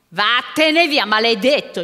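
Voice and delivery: put-on furious storybook old man; angry dismissal. A narrator's reading. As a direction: drive the ogre off with a loud curse. Old man chases the ogre away.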